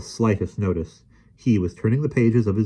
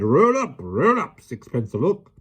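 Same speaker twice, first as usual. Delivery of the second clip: loud, high showman's cry; fairground barker; 8 semitones higher, 4 dB louder than usual. A narrator's reading. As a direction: call the crowd in. Showman calling crowd.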